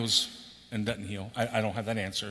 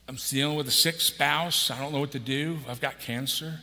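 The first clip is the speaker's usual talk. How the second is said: a hint of a weary plea; struggling listener pleading; 4 semitones higher, 6 dB louder than usual. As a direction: pour out the burdens, then sink. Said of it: "voicing struggling listener".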